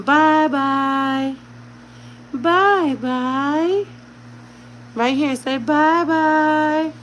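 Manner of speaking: drawn-out motherese; baby talk. Shown as a demonstration. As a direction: coax him gently and slowly. Coaxing baby to say bye-bye.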